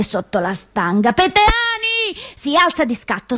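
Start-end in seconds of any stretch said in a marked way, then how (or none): 1.1-1.8 sharp, much higher call across the street. Acted. Someone at the level crossing calling out to Ondina, sharp and high. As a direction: call her surname to stop her.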